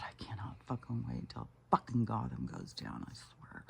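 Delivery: hushed, seething whisper under the breath. Real, not acted. Vlogger ranting at Gotham drops to a seething whisper. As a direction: drop to a furious whisper.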